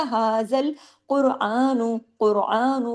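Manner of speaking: slow هَذَا الْقُرْآنُ with heavy qaf and ra; tajweed recitation. Shown as a demonstration. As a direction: stretch the ha of هَذَا. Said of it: "قاف بھی ہیوی، را بھی ہیوی".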